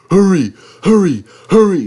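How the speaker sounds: high, loud urgent chant; puffing hurry. Acted. Henry racing through the snow, high loud puffing. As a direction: drive the rhythm of a speeding engine. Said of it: "puffed Henry".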